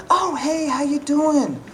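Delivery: high, overly chummy coworker; high, slow mock schmooze; fake friendly coworker. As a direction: play the fake-friendly office guy. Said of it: "act like they know you".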